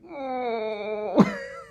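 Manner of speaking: high, squealed mock whimper. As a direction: imitate someone about to burst into tears. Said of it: "we see Gwen's face like".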